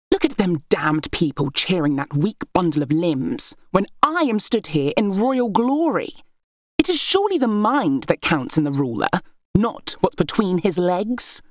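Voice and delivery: high, arrogant royal sister sneering down; scornful heir. Acted. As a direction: lord it over your sisters with scorn. Berenice's contemptuous rant.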